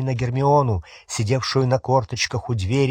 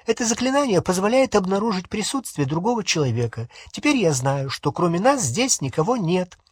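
higher-pitched, testy lecturing; peevish explanation; 6 semitones higher than usual. A narrator's reading. Hermione telling Ron what the spell does, peevish. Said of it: "сварливо ответила Гермиона".

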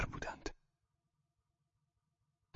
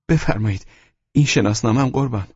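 1 helpless plea; 7 semitones higher, 6 dB louder than usual. A narrator's reading. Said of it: "در نهایت بیچارگی گفتم".

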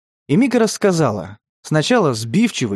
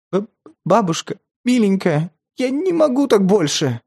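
sobbing; 6 semitones higher than usual. A narrator's reading. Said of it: "сквозь всхлипы".

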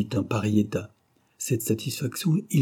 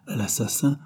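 plain reading voice

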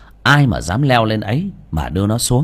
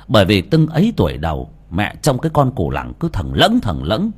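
usual speaking voice

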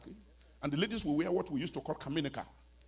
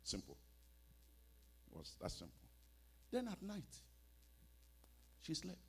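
ordinary talk

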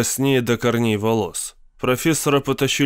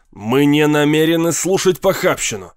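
high, strained indignation; choking outrage; 4 semitones higher, 4 dB louder than usual. A narrator's reading. Captain refusing to hear obscenity, choking. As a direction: force out the outraged refusal, pitched up. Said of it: "задыхаясь, проговорил капитан".

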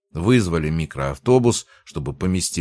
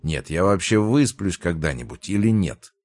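ordinary delivery

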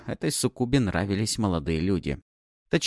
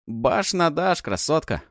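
smirking; 7 semitones higher, slower than usual. Acted. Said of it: "ухмыльнулся я".

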